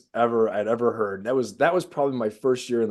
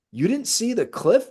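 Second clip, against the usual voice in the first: high, slow disbelieving question; high incredulous retold question; 12 semitones higher, slower than usual. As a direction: ask in total disbelief.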